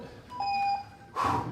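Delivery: heavy winded sigh; breathless sigh. Real, not acted. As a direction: exhale hard, catching breath. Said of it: "I'm out of breath from running in here".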